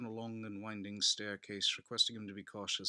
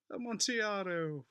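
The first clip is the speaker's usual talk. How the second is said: high, slow, savoured cry of the name; drawn-out greedy exclamation; a wine lover hooked by the bait; eager exclamation; 12 semitones higher, 5 dB louder than usual. A narrator's reading.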